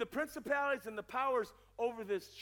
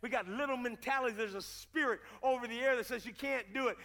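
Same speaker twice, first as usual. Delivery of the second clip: usual speaking voice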